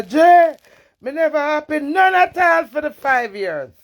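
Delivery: high, loud mock bawl. Wife retells husband's complaint about five years, loud bawl. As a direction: bawl it out as the aggrieved man. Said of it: "The man ball out say".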